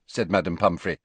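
plain reading voice

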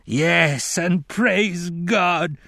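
high, drawn-out tearful praise; weeping fervor. A narrator's reading. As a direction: wail the praise slowly through tears. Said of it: "Johnson wept".

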